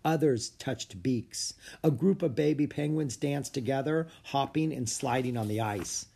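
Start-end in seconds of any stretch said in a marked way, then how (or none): none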